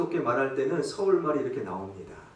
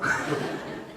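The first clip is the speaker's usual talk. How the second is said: laugh; 4 dB quieter than usual. Real, not acted. Laughs at his own joke.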